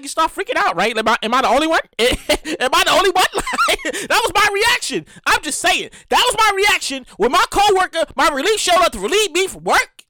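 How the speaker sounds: ordinary delivery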